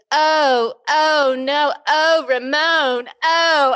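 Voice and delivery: hammed-up movie sex moans; Romy moaning. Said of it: "she was like".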